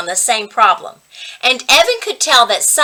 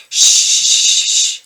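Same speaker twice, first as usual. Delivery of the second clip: rattling shush; 4 dB louder than usual. A narrator's reading. Shh sound effect.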